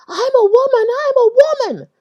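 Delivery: high, loud shrieking insistence; retold scream. Man in the washroom screaming at the speaker, high and loud. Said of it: "he screamed at me".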